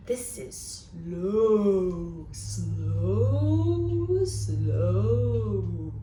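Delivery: slow, stretched long words; very slow drawn out. Shown as a demonstration. Librarian showing slow to children, dragging it out. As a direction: draw out every slow lazily. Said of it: "And it goes like this".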